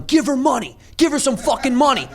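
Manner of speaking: high-pitched demanding mock yell; retold yelling. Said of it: "in the middle of yelling at this guy".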